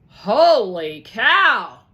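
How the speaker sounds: slow, loud amazement; stunned exclamation. Acted. Fire seeing all the cash, slow and amazed. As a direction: let it roll out in awe.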